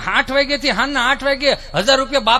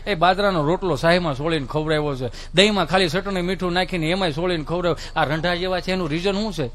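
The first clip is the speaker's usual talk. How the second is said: usual speaking voice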